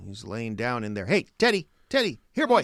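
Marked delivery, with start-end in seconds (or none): 1.1-2.6 high, loud calls for a dog; calling a lost pet. Acted. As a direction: call the dog from the shed.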